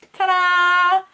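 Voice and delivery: high, loud, drawn-out ta-da; excited reveal. Real, not acted. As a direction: unveil it like a magic trick. Reveals the final look.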